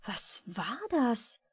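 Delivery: slow, hushed whisper of fright; fearful whisper. A narrator's reading. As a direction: breathe the question in sudden fear. Frightened inner question. (erschrockenes Flüstern)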